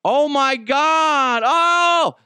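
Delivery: high, loud, slow shocked cry; startled double take. Retold or imitated. A former player recognising him on the sidelines, shocked cry. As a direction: act out being caught red-handed. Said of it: "he does a double take".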